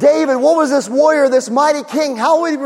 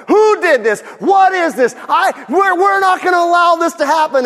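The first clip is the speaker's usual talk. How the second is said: higher, louder indignant shout; angry outburst; 6 semitones higher, 4 dB louder than usual. Acted. Preacher plays King David hearing of the stolen lamb, angry. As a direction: blow up in outrage as the upset king. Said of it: "He's just angry, and he's like".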